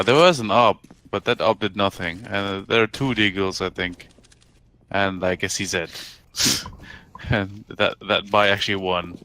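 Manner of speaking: normal talking voice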